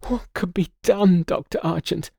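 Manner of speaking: high, pleading cry; distraught cry. Acted. Daughter crying out to the doctor, high and desperate. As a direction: beg the doctor for help. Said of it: "interrupted him with a cry".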